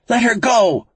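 high, forceful shouted demand; shouting. A narrator's reading. Frankie demands the captor free the captive, shouting. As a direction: shout it desperately down the phone. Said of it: "she shouted into the phone".

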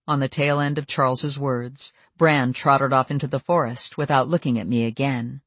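usual speaking voice

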